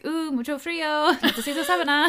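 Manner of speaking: high, loud mock Spanish accent; imitating Spanish speakers. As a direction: play the locals asking for a sheet, laughing. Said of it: "they always were like".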